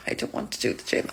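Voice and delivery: soft voiceless whisper of reluctance; whispered dread. Acted. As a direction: whisper it like you're hiding from the gym. Dreading starting the gym.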